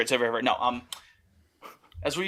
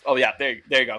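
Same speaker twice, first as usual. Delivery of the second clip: ordinary talk, nothing marked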